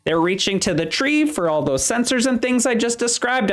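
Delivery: a chipper supervisor showing new hires around; cheerful elf foreman. Acted.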